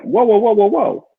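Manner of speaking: high, hurried whoas of alarm; mock alarm. Money shows up and you panic, high mock alarm. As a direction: throw up your hands and back off. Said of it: "you're like".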